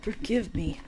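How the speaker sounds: loud, high, wailing apology; sobbing. Acted. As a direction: sob it out in horror. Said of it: "began to sob once more".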